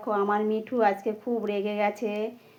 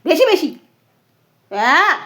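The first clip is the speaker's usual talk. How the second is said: sharp real outcry; 3 semitones higher, 7 dB louder than usual. Real, not acted. Parrot biting, she cries out.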